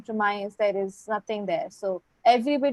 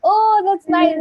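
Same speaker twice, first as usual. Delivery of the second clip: excited; 8 semitones higher, 7 dB louder, slower than usual. Real, not acted. Reacting to seeing a gift.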